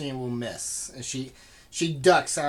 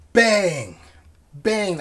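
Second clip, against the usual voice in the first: loud; 5 semitones higher, 9 dB louder than usual. Shown as a demonstration. DM imitates banging on door.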